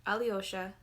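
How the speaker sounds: usual speaking voice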